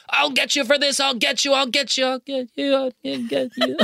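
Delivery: high, repeating threat, losing steam; squeaky dubbed villain sputtering out; sputtering foiled villain. Retold or imitated. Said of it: "This was hilarious to me because it's all, you know, dubbed".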